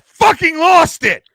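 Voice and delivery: loud, high cry of frustration; anguished outburst. Real, not acted. Owning up to co-host about losing signed jersey, anguished outburst. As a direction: burst out in exasperation at yourself.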